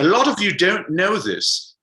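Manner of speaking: put-on high, slow, stately British accent; hammed-up British speechmaker, pitched high and drawn out. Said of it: "so I'm gonna do my British accent".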